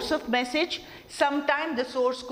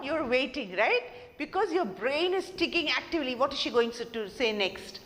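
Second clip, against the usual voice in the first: ordinary talk, nothing marked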